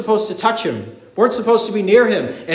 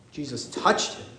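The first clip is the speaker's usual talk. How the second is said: "Jesus touched him" softly, lower, in awe; hushed awe; 6 semitones lower, 10 dB quieter than usual. Shown as a demonstration. Preacher marvelling to the congregation, hushed awe. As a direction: drop it to a hush. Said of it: "repeated for emphasis".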